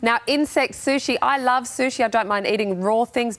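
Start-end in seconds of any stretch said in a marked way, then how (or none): none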